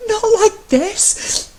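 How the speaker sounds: high, slow, tearful plea; a high voice near breaking into a sob; sob in his voice. Acted. Matt pleading to his husband, close to tears. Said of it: "a sob in his voice".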